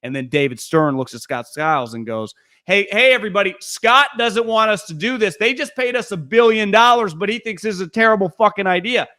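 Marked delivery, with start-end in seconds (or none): 2.6-9.1 mock announcement to the room, pitched up; sarcastic callout. Commissioner calling out a coach before the whole meeting, mock-incredulous. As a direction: act out a boss sarcastically singling someone out, higher voice.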